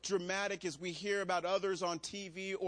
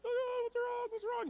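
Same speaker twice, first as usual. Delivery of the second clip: much higher, squeaky mock panic; faster than usual. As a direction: play the flustered pastor, hammed-up alarm. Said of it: "And you're like".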